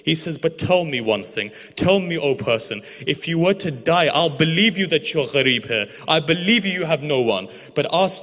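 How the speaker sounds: high, loud impassioned lament sermon; preaching at full force. Acted. Preacher recounting the tragedy to the mourners, impassioned. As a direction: pour it out like a grieving preacher.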